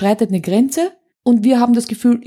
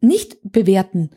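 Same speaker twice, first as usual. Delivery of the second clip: usual speaking voice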